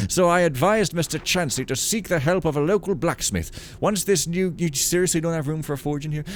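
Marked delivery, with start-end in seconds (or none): 0.1-4.5 raised, formal priestly letter voice; old priest reading letter. Acted. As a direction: read it like a pious old priest.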